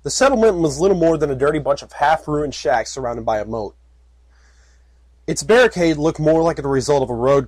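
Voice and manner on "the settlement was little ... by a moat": usual speaking voice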